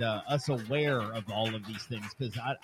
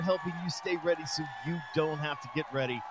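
loud, high show opener; hype host intro; 7 semitones higher, 4 dB louder than usual. Acted. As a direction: pump up the crowd. Hypes up show opening.

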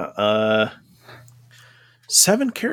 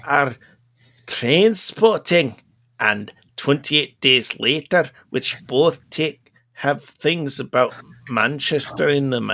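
put-on Mancunian accent; 2 semitones higher than usual. Continues the Mancunian character.

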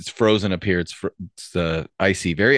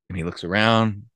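ordinary delivery

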